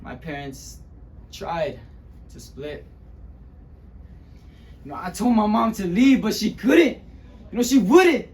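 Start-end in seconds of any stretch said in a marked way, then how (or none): 4.8-8.3 loud, high-pitched desperate anger; anguished outburst. Acted. [name] recalling begging his mom to leave, anguished and yelling. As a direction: break out in pained, yelling frustration. Acted outburst at mother who stayed.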